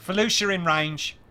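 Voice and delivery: high, loud hammed-up Cockney officer; mock Cockney accent. Imitating auditioning actors reading a Cockney Imperial officer. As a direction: bark it out in a bad EastEnders accent. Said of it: "I can't do that accent".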